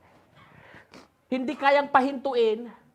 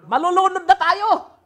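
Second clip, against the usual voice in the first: frantic plea; 6 semitones higher, 7 dB louder than usual. Acted. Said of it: "sabi niya".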